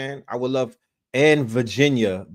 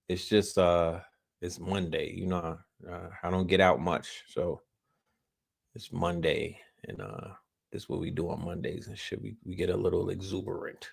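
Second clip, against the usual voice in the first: quiet calm-down; 7 semitones lower, 10 dB quieter than usual. Real, not acted. Calms down after excitement.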